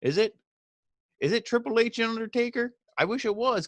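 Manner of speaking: high mocking questions; sarcastic disbelief. Real, not acted. Sarcastic reply to "end of an era.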